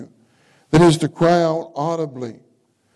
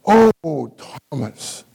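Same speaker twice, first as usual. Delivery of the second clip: fond plea to the listener; longing call; much slower than usual. Shown as a demonstration. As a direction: say Oh, Thomas as if you really want him. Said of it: "But if I say".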